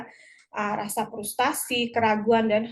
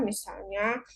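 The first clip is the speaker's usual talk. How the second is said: ordinary talk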